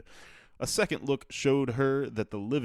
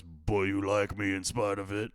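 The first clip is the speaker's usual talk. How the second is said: grandfather voice. Acted. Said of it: "should I do a grandfather voice?".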